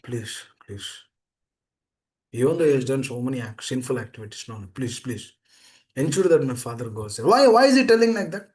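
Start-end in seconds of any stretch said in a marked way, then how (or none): 0.0-7.3 praying. Acted. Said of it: "he is praying to Lord Nrsimha".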